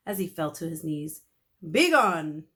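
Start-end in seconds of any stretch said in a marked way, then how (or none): 1.7-2.5 loud, grand dismissal; mock-regal king; royal command. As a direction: banish him grandly. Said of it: "Mister Klutz said".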